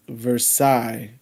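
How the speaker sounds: slow "Versailles" ending like sigh; French pronunciation. Shown as a demonstration. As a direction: stretch "Versailles" into ver-sigh. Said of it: "Like, sigh".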